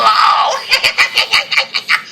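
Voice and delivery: sudden high airy laugh; cracking up hard. Real, not acted. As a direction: burst out laughing at the name. Laughing at Willow's name.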